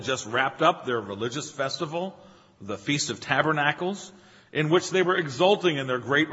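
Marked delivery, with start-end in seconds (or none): none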